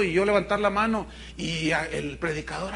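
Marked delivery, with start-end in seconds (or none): none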